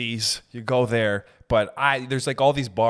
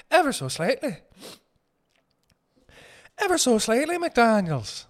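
mock fancy stylist; 11 semitones higher than usual. Comedian playing a fussy stylist describing a haircut, high and dainty. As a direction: coo it like a fussy hairdresser.

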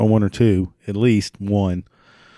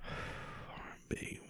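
whispering; 28 dB quieter than usual. Real, not acted. Muttered aside while searching.

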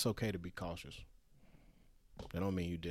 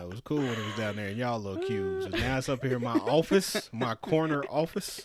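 boss voice; 2 semitones higher, 6 dB louder than usual. Retold or imitated. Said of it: "He like".

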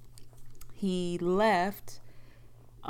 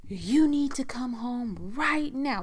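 slow, higher-pitched insistent order; retold stern demand; 5 semitones higher, slower than usual. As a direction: imitate someone demanding, drawing out each word.

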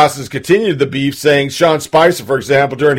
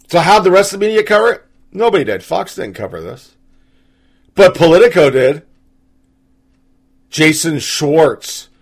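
usual speaking voice

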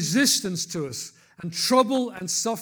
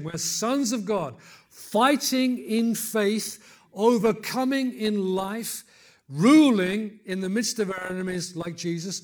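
louder, driving declaration with key words drawn out; emphatic preaching; 4 semitones higher, 6 dB louder, slower than usual. Real, not acted. Preacher declares to congregation they are sons of God, emphatic preaching. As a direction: preach it with force, word by word.

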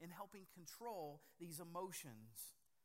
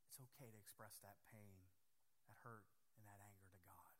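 hushed intimate preaching; 6 semitones lower, 12 dB quieter than usual. Acted. Softly probing the congregation.

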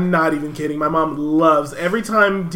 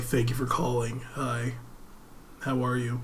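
softer polite call center voice; softer phone voice; 4 semitones lower, 11 dB quieter than usual. Shown as a demonstration. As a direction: answer the phone softly and politely. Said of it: "slip into this".

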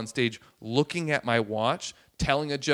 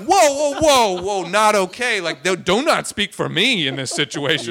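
loud, high retold protest; alarmed objection; 6 semitones higher, 11 dB louder than usual. Comic disowning a heckler's remark, alarmed. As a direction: cut them off in mock alarm.

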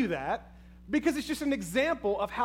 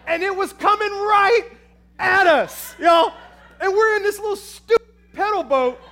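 loud and high with rising alarm; excited yelling; 9 semitones higher, 8 dB louder than usual. Real, not acted. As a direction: tell it like it's still coming at you. Telling snake coming at them.

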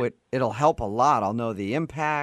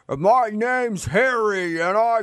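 Harry voice; 10 semitones higher, 4 dB louder, slower than usual. Retold or imitated. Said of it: "was it".

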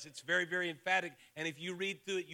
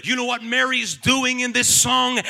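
loud, climbing sermon shout; full-force preaching; 4 semitones higher, 14 dB louder than usual. Real, not acted. Preacher declaring God's promise already fulfilled, full-force preaching. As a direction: drive it home at the top of the voice.

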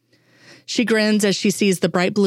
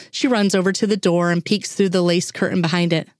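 usual speaking voice

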